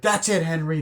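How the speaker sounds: high-pitched imitated outburst; mock angry outburst. Film fan acting "jumps on him", high mock outburst. Acting out character snapping before attack.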